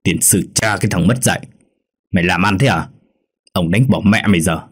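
angry curse through gritted teeth; contained menacing rage; seething old master. A narrator's reading. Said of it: "Lão rít lên qua kẽ răng".

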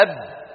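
أب with the lips pressed shut, no release; held stopped baa. Shown as a demonstration. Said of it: "كذلك الباء". (أب بإطباق الشفتين دون إفلات)

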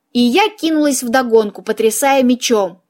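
usual speaking voice

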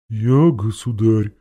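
slow, drawn-out donkey voice; donkey's voice. A narrator's reading. The donkey answering the king, in a donkey's voice. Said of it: "послышался голос осла".

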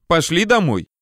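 повыше, визгливый приказ; позови резко, слегка взвизгнув. A narrator's reading. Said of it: "взвизгнул вдруг он". (повыше, с лёгким визгом)

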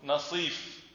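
نصيف as naseef, long ee; fourth variant. Shown as a demonstration. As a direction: stretch the ee in نصيف after a short na. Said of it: "هناك لغة رابعة تقول".

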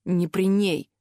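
restrained, pained request; mastering pain. A narrator's reading. Master Weiss asks to spare Eliza, mastering pain. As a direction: hold the pain back while speaking. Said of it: "справившись с болью".

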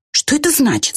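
high-pitched, quick question; brisk puzzled little character; puzzled ant. A narrator's reading. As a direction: ask high, then drop at the end.

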